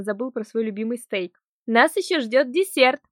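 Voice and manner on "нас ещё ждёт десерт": loud, slow exclamation; deliberately loud. Acted. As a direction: announce it loudly for the listener. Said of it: "нарочито громко воскликнула я".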